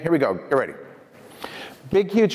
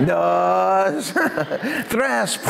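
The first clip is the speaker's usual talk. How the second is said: Spanish-flavoured count; 6 semitones higher than usual. Retold or imitated. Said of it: "It'll probably look more like this".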